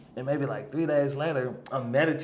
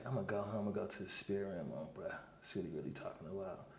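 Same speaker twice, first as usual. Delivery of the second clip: muttered to himself; 8 semitones lower, 19 dB quieter than usual. Retold or imitated. Private resolve, muttered.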